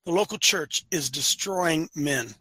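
slow, hushed, deliberate delivery; real slowly. Real, not acted. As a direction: spell it out slowly and quietly. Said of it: "I'll say it again real slowly".

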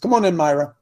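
ordinary talk

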